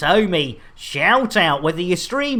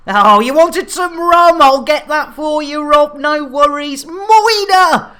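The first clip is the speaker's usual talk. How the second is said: high, loud cheery calls; excited calling out; 9 semitones higher, 7 dB louder than usual. Real, not acted. Streamer cheerfully serving drinks to viewers, loud and high. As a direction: greet them with bright energy.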